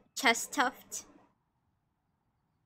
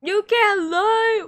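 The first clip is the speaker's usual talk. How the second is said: loud, high, slow accusation; over-the-top playful accusation; mock outrage; 10 semitones higher, 10 dB louder, slower than usual. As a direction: accuse in hammed-up indignation.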